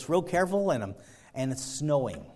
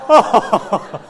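loud, very high cry of relief; overjoyed relief; 12 semitones higher, 17 dB louder, much slower than usual. Retold or imitated. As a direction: burst out thanking God. Relief at boss's confession.

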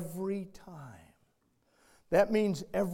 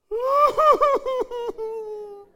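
high, breaking laughter; 11 dB louder than usual. Real, not acted. Pastor laughing at his own story, high and loud.

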